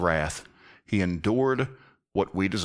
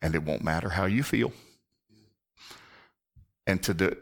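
ordinary delivery

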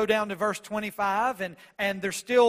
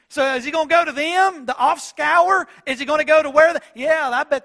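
much higher, louder, sneering question; scornful mock shout; 6 semitones higher, 9 dB louder than usual. Pharisees scorning Gentiles as dogs, loud sneering shout. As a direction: spit out the contempt for Gentiles. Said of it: "they're being sarcastic".

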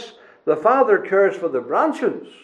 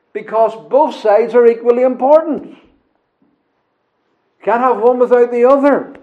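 louder, driving pulpit preaching; 5 semitones higher, 5 dB louder than usual. Acted. Preacher urging the congregation, louder and driving. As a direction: press the point home to the congregation.

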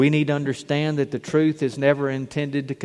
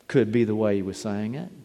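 ordinary delivery

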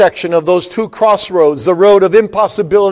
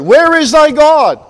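much higher, louder, slow mocking question; scoffers' sneer; 10 semitones higher, 7 dB louder, slower than usual. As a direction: throw the taunt up like a sneering scoffer. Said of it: "The scoffers sneer".

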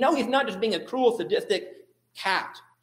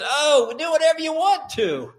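high, louder mock grandpa warble; doddering grandpa voice; 8 semitones higher, 5 dB louder than usual. Preacher mocking a senile grandpa God, high doddering voice. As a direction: wave it off like a befuddled old grandpa.

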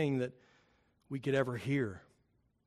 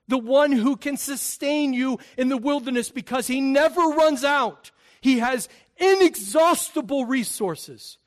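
higher, louder rising proclamation; a preacher's climb to a loud peak; preaching at full force; 7 semitones higher, 7 dB louder than usual. Acted. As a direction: build it up toward the congregation. Preaching climax on God's name.

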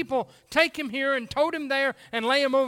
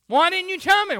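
accusing cry; 6 semitones higher, 6 dB louder than usual. Pretend. Lost soul accusing at judgment.

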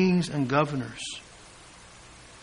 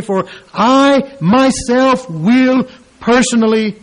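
slow, loud, higher proclamation; preaching at full force; 4 semitones higher, 8 dB louder, slower than usual. Acted. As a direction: declaim each word as a promise. Preaching Jesus' promise.

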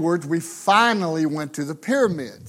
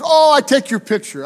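lightly voiced vendor, higher and louder; a hint of a pushy street seller; Egyptian vendor woman; 6 semitones higher, 5 dB louder than usual. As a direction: imitate an eager-to-sell Egyptian woman in simple English. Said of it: "Egyptian woman, older woman".

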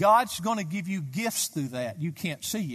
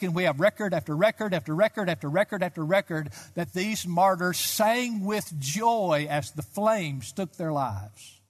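ordinary delivery

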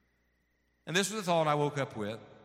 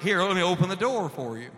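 mock dog command; 4 semitones higher, 6 dB louder than usual. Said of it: "it's like".

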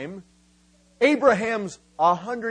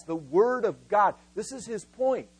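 normal talking voice

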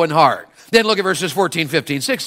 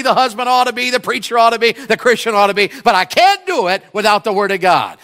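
normal talking voice

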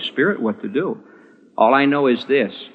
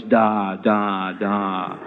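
put-on baby babble; 5 semitones lower, 4 dB quieter, much slower than usual. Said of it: "husband looks at his wife and says".

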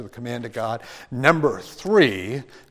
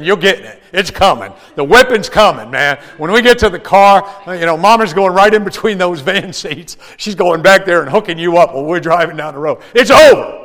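louder, higher warning through winks; mock threatening father; 5 semitones higher, 14 dB louder than usual. Father warning his son across the table, loud mock threat. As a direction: play up the threat of a whipping. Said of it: "I'm saying".

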